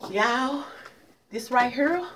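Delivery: ordinary talk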